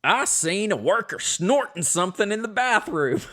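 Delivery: put-on playful Southern accent, reading a complaint aloud. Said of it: "Again, always Southern".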